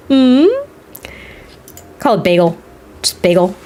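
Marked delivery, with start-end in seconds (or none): none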